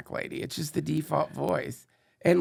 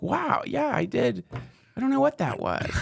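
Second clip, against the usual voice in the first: playful AI voice; 8 semitones higher than usual. Retold or imitated. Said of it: "And it's like".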